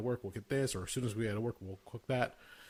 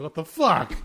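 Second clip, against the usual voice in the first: higher, louder shocked outburst; retold startled shout at the mess; startled cry; 7 semitones higher, 11 dB louder, slower than usual. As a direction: blurt it out in alarm. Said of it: "I'm like".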